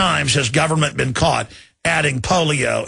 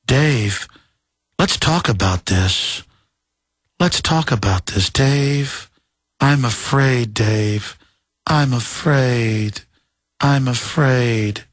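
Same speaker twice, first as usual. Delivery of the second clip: lower, slow calm computer voice; HAL 9000; 3 semitones lower, much slower than usual. Retold or imitated. Host imitates the calm HAL computer. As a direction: drawl it out like a calm machine. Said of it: "how is the hal nine thousand talking to him?".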